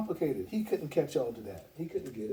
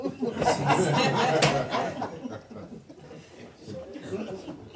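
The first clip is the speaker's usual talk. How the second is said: loud falling laugh at her spending; 4 semitones higher, 7 dB louder than usual. Real, not acted. Laughing at his wife's shopping habit, loud falling laugh. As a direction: let a big laugh roll out and fall away.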